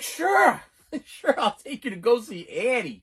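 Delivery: high, drawn-out mock shout; hammed-up fatherly consent; exasperated giving in. Acting father giving in to begging.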